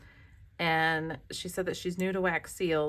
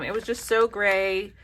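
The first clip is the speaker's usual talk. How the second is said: ordinary delivery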